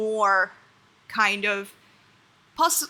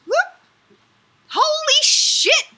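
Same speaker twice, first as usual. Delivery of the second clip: high, loud, laughing thanks; overjoyed, laughing shout of thanks; excited squeal; 5 dB louder, much slower than usual. Real, not acted. Reacting to gifted subs live.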